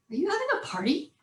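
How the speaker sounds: high, quick rising question; baffled husband. Retold or imitated. Husband hearing kids dance in background, baffled. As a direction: imitate sudden incredulity.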